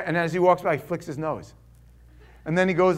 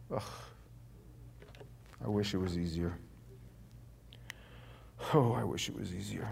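weary wish; 10 semitones lower, 13 dB quieter than usual. Acted. Sighing wish in believer's voice.

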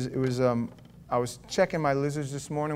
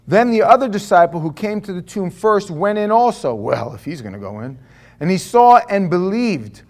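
raised, ringing Bible reading; louder formal scripture read; 5 semitones higher, 8 dB louder than usual. Acted. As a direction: read the verses out to the congregation. Pastor reads scripture aloud.

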